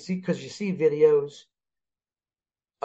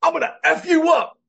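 much higher, louder mock threat; mock angry threat; 13 semitones higher, 4 dB louder than usual. The hit player threatens the bead thrower, mock fury. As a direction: ham up a furious player's threat. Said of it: "He goes".

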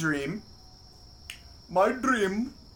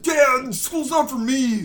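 put-on whiny teen son; 6 semitones higher, 5 dB louder, slower than usual. Son talks back to dad.

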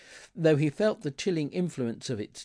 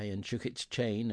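usual speaking voice

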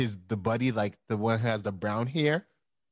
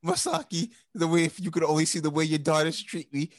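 hammed-up grieving husband; dramatic whining; 6 semitones higher than usual. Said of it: "He's like".

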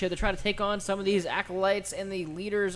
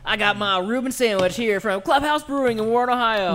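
louder, higher pitched venue plug; enthusiastic plug; 5 semitones higher, 7 dB louder than usual. Shown as a demonstration. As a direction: pump up the plug with energy. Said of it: "Lower your octave".

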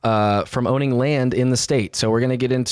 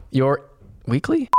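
ordinary talk, nothing marked

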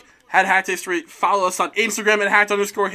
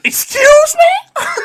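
shocked; 8 dB louder, much slower than usual. Acted. Mock startled girl in story.

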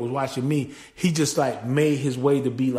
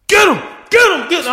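high, loud yelling at the fight; dad shouting at a boxing match on TV; 9 dB louder than usual. Retold or imitated. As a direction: shout at the TV like a fired-up fan. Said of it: "he'd be like".

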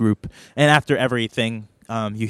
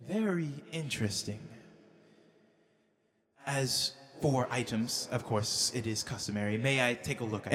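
very soft, measured, regal voice; Fey Queen; 13 dB quieter than usual. Acted. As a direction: speak softly, like a queen who needn't raise her voice. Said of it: "And she goes".

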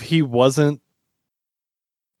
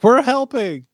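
high, louder hero cry; eager mock cheer; 12 semitones higher, 4 dB louder, slower than usual. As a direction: pipe up like an over-eager sidekick team. Acting out eager heroes rolling through.

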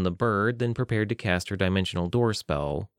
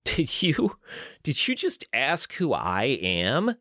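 slow, higher disbelieving laugh through words; 5 semitones higher, slower than usual. Acted. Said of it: "I laughed".

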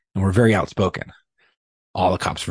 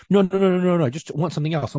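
mock flustered boss; 9 semitones higher, faster than usual. Supervisors backpedal in retold argument.

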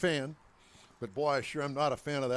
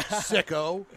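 laughing; 4 semitones higher, 4 dB louder than usual. Real, not acted. Teasing the man about his young wife.

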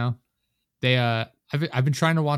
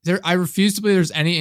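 indignant exclamation; 7 semitones higher, 6 dB louder than usual. Retold or imitated. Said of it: "I'm like".

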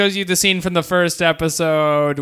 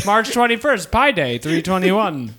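normal talking voice

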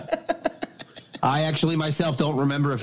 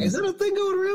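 high mock voice; faster than usual. Mocking question in high voice.

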